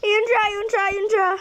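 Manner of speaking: piercing scream then frantic high yelling; terrified scream. Real, not acted. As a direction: scream and point it out. Jump scare.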